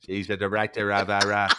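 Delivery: exaggerated Boston accent. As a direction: lay on a thick Boston accent. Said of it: "Say that in a Boston accent for me".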